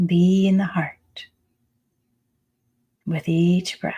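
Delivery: unhurried meditation voice with long pauses; calm, spacious meditation guiding; guided meditation. Acted. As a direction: lead them into the breath, phrase by phrase. Leads a breathing meditation.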